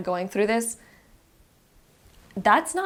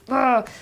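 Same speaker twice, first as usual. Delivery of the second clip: higher, louder effortful "ugh"; strained groan; 5 semitones higher, 6 dB louder than usual. Acted. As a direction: grunt as if pushing against a weight. Acting out struggling under obstacles.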